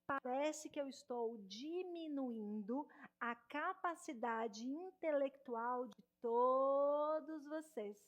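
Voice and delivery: exaggeratedly slow, stretched-out words; too slow. Shown as a demonstration. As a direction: drag every word out as if to slow listeners. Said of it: "fala devagar demais".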